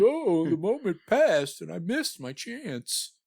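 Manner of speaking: high, put-on woeful voice; mock lament. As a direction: wail melodramatically over a lost chance. Mock lament of woman missing chance.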